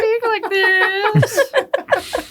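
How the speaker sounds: very slow, high laughing hobo voice; cracked rambling vagrant. Retold or imitated. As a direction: drag it out like a cracked old vagrant. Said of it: "What's your hobo voice?".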